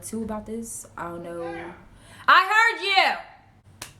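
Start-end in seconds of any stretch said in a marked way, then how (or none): 2.3-3.9 loud, high, slow call to someone in another room; yelling across the house. Real, not acted. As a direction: shout it so they hear from far off. Calling out to someone offstage.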